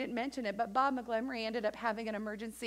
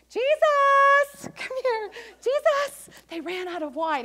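much higher, louder mother's call; Mary calling Jesus; 13 semitones higher, 10 dB louder, slower than usual. Acted. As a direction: call him over like an eager mom. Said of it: "the mother of Jesus said to him".

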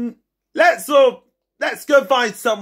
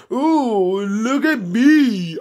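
slow put-on mocking voice; teasing imitation of Joseph strutting; slower than usual. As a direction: show off in a silly voice. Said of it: "walking like this".

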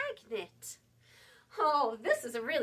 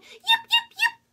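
very high, much louder yipping call with a laugh; loud fox yip; 13 dB louder than usual. Acted. Little fox arrives at the barn, yelping loudly. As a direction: yelp it out at the barn door, laughing. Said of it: "She called".

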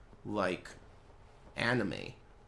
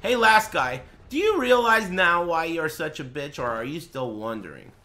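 raised, high-pitched taunting call; loud scolding; 4 semitones higher, 6 dB louder than usual. Real, not acted. Streamer taunting the last viewer, loud scolding. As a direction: call them out loudly, pitched up. Berating the previous chat viewer.

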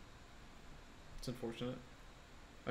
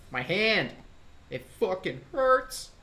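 pained yell; 5 semitones higher, 5 dB louder, slower than usual. Real, not acted. Complains hand hurts mid-game.

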